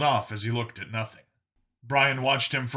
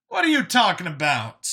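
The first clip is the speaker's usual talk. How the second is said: higher, louder baffled character voice; Brian's baffled voice; 7 semitones higher, 6 dB louder than usual. Acted. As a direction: challenge it, pitched up and loud. Brian challenges Vincent in dialogue.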